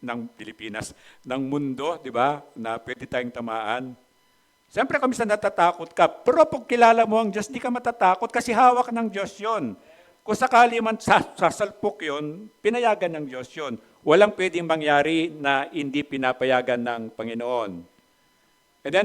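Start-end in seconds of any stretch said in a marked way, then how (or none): 4.7-14.6 high, loud preaching push; rising to full preaching force; fervent preaching. Acted. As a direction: preach it out to the congregation.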